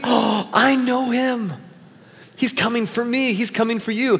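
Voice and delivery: gasp, then say it in high-pitched wonder; excited like Elf. Shown as a demonstration. Pastor reads the creed as Elf would, excited. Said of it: "we can read it like that".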